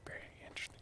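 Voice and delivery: hushed whisper. Acted. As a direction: breathe it out as a whisper. Whispered after plain "very interesting.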